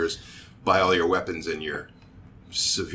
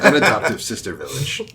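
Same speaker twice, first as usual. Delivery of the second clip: laughing; 5 semitones higher, 6 dB louder than usual. Real, not acted. Gives in to players' joke, laughter.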